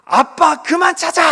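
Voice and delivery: put-on high, loud annoyed retort at the kids; irritated snap. Tired father snapping at his kids, an annoyed outburst. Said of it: "막 이렇게 제가 짜증을 낼 때가".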